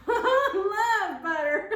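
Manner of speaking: laughing, slow and loud. Real, not acted. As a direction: gush through a laugh. Laughing gush about butter.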